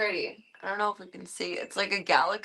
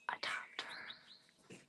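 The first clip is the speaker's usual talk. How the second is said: whispered; 19 dB quieter than usual. Real, not acted.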